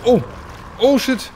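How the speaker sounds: high startled swear; startled shout. Real, not acted. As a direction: jump and yell in fright. Sudden scare in game.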